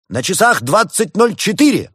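put-on high, loud teenager's call; ringing high proclamation; shouted announcement. A narrator's reading. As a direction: shout the time out to the whole room. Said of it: "выкрикнул подросток в потолок".

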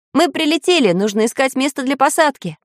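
high, joyful announcement; pleased, high-pitched excitement; delighted. A narrator's reading. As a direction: announce the arrival gladly. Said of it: "обрадовался Самоделкин".

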